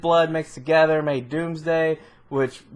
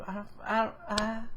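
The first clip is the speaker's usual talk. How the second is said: very soft, faltering stammer; mock helpless stammer; 5 semitones higher, 11 dB quieter than usual. Superman blamed for not saving everybody, mock helpless stammer. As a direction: trail off softly, pretending to have no answer. Voices Superman unable to answer.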